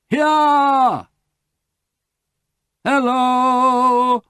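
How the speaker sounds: high, loud answering shouts; calling back. A narrator's reading. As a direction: shout back so they find you. Answering the rescuers' call.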